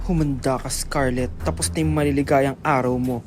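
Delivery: jealous rival swearing revenge; villain threat. Acted. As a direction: threaten her like a soap villain.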